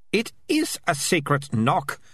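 slow, insistent snap, stress on is; snapped. Acted. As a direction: snap it back indignantly. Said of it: "Melicia snapped".

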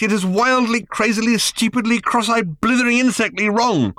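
exasperated rant. A narrator's reading. Arthur despairing at Ford's thinking, exasperated rant. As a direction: pour out the frustration.

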